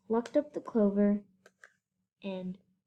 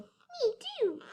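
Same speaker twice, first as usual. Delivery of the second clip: high squeaky little kangaroo, much higher; small high echoing joey; tiny joey voice; 4 dB quieter than usual. A narrator's reading. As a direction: pipe it up like a baby in a pouch. Young kangaroo voiced by narrator.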